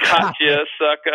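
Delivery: teasing gotcha. Retold or imitated. Alan revealing his prank to Todd, loud gleeful taunt. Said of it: "He's like".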